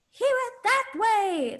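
put-on high, slow, loud little-kid voice; imitated toddler piping up in a theater; eager toddler. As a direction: call out helpfully like a toddler. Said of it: "there was, like, a toddler".

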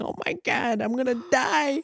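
high, laughing, slowed mock panic; mock scared squeal. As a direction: squeal it like a little girl on a ride. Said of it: "screaming like a little girl".

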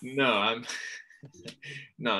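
ordinary talk, nothing marked

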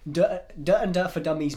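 "duh" shouted loud and teasing; loud playful heckle of the show name; mocking shout. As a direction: yell the title out loud like a joke. Mocking the podcast title.

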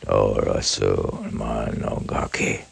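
slow, low, measured poem reading; reciting a Japanese poem slowly; haiku recitation. Acted. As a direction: intone the haiku slowly and deeply.

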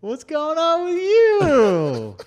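high, drawn-out retold question; mock concerned question. Retold question to crying friend.

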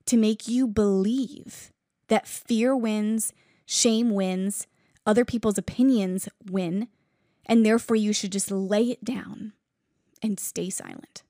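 ordinary talk

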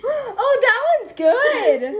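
laughing high and loud. Real, not acted. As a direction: burst out laughing. Bursting laugh after joke.

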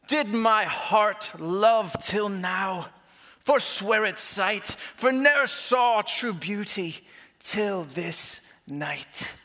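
slow, hammed-up Romeo accent; drawn-out mock-Shakespearean accent with pauses; theatrical Shakespearean accent. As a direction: declaim it slowly in a stagey Shakespeare accent. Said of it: "where that accent came from".

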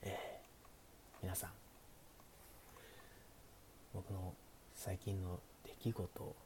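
hushed late-night chat, often whispered; sleepy. Pretend. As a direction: talk softly like the listener is drifting off. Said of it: "寝落ち配信なんでね". (小声でささやく寝落ち配信トーク)

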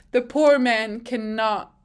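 ordinary talk, nothing marked

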